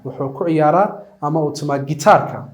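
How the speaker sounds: normal talking voice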